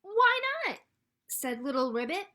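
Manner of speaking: loud, high frog reply, then bouncy sound words; animated storytime reading. A narrator's reading. Frog lets duckling join the bouncing, animated storytime delivery. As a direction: sing out the answer and bounce through the silly words.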